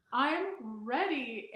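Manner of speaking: slow, far-off mock heroic "I'm ready"; mock heroic resolve. Ready to face the ghost like Buffy, mock heroic and slow. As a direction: play the vampire slayer, drawn out and grand. Armed like Buffy the Vampire Slayer.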